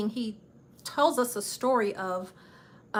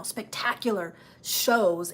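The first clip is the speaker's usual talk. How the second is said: normal talking voice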